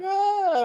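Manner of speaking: sudden high ah; a startled high breath out; soaring gasp; high gasp of relief. Retold or imitated. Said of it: "I'm going like".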